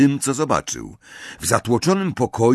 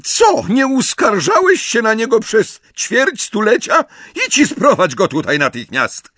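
much higher, loud yelled orders; furious shouting; 12 semitones higher, 5 dB louder than usual. Acted. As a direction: bellow at him. McGonagall shouting orders at Filch.

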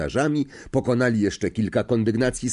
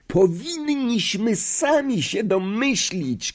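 Ron; 13 semitones higher, slower than usual. A narrator's reading. Boy exclaims they should have guessed, high acted cry. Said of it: "krzyknął Ron".

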